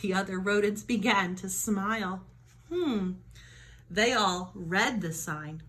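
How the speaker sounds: ordinary delivery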